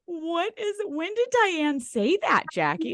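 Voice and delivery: high, puzzled mock voice; imitating co-host's confusion. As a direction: mimic a baffled friend, pitched well up. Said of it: "before she was like".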